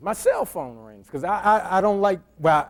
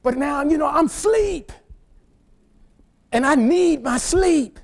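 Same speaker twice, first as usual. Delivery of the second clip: exasperated complaint; 9 semitones higher than usual. Retold or imitated. Complaining about lost sleep.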